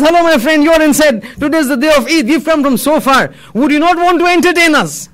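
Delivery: high, playful appeal; mildly joking. A narrator's reading. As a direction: call out teasingly with the pitch up.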